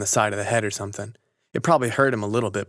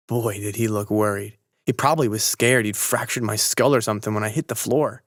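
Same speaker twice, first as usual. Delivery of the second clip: ordinary delivery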